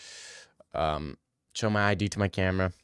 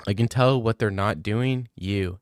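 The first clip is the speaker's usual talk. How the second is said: normal talking voice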